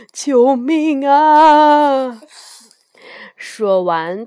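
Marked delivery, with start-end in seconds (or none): 0.1-2.2 loud, higher, long-drawn cry for help; frightened cry. A narrator's reading. Ye Gong running from the real dragon, frightened yell. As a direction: stretch out a panicked yell for help. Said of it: "叶公吓得直发抖".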